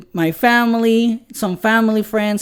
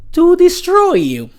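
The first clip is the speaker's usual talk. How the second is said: higher and louder, showing the angry voice; aggressive threat; 10 semitones higher, 5 dB louder than usual. Shown as a demonstration. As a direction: demo of going on the attack. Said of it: "my voice will change".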